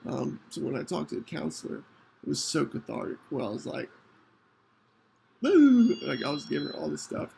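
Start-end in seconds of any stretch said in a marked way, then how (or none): 5.4-6.0 mock crying wail.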